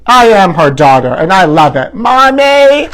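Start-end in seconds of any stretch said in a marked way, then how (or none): 1.9-2.9 high, loud shriek of Mommy; calling out. Acted. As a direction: yell across the crowd to mom. Daughter calls out to drunk mother.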